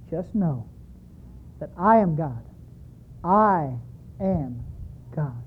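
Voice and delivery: low, slow, hushed words from God; deep slow voice of God. Retold or imitated. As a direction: speak slowly and softly as God. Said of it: "And the word came forth".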